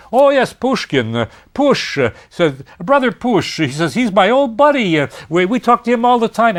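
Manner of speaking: high, puffed-up name-dropping; boastful Khlyestakov. Retold or imitated.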